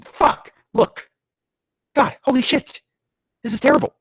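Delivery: high, loud outraged ranting; exasperated rant. Real, not acted. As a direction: rant in disbelief at awful writing. Reader rants at terrible writing.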